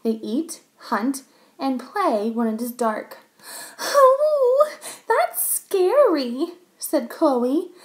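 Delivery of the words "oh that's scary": high, loud, drawn-out whimper; frightened little girl. A narrator's reading. Girl scared of animals out in the dark, high frightened whimper. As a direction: wail it like a scared small child.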